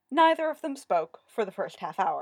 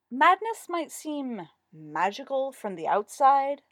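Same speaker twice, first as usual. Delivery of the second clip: slow, raised, tightly controlled irritation; strained restraint; 5 semitones higher, 5 dB louder, slower than usual. A narrator's reading. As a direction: hold back annoyance through gritted teeth. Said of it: "trying not to grit his teeth".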